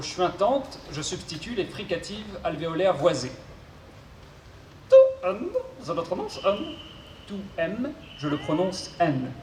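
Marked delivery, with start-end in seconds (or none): 4.9-6.7 mangle the consonants like a glitchy robot. Acted. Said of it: "souffre d'une pathologie vocale".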